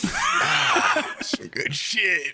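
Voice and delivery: high drawn-out stoner voice; stoned streamer voice. Acted. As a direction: savor it like a blissed-out stoner. Acting stoned streamer with weed.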